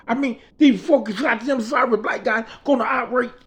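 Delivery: high-pitched, indignant retold rant; irate rant. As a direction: act out an outraged complaint.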